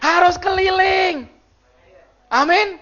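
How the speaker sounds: high, slow laughing shout. Acted. Pastor shouting the punchline to the church, laughing.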